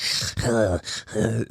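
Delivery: congested, raspy breaths in and out; wheezy breathing. Retold or imitated. Swim teacher mimicking the baby's congestion, wheezy breaths. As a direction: imitate a stuffed-up wheeze. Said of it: "he's kinda breathing like that".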